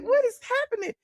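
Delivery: high-pitched mock alarm; retold bewilderment. Streamer reacts to a flood of alerts, high mock alarm. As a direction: squeal it softly in exaggerated confusion.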